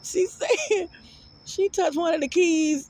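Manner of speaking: laughing while talking. Real, not acted. Telling viewers the piano story, still giggling. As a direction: push through the giggles. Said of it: "I literally had tears in my eyes".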